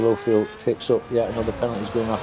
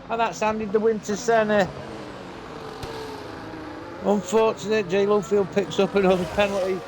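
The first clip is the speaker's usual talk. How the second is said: excited; 9 semitones higher than usual. Real, not acted. Final-lap spin decides the race.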